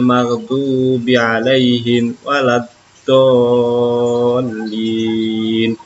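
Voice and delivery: slow, measured recitation with long vowels held; careful Quran recitation. Shown as a demonstration. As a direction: recite slowly, drawing out each long syllable for learners. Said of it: "awas harus diperhatikan panjang dan pendeknya". (mengaji pelan dengan panjang-pendek yang jelas)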